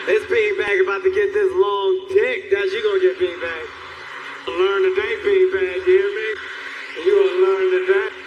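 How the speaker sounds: loud, forceful hammed-up swagger; mock lewd threat. Messed up man threatens a beanbag, in loud mock menace. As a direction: lay down the law to it.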